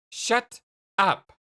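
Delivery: firm, irritated command to stop; firm angry order. Acted. Jane telling confused Jake to stop, firm and angry.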